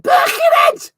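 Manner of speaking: very high, loud Scouse shout; Liverpudlian accent. Retold or imitated. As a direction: screech it in a hammed-up Liverpudlian accent. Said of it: "spat his beer out at my Liverpudlian".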